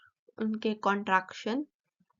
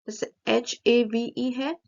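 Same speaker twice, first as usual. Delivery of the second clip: ordinary talk, nothing marked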